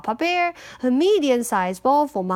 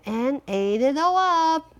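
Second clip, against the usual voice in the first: usual speaking voice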